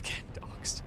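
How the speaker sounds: breathy whisper with a laugh. Real, not acted. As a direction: whisper it with a giggle. Whispered aside about doxing.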